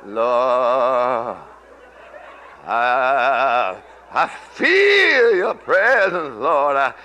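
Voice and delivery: slow, intimate prayer voice; hushed worship prayer. Acted. Prays aloud.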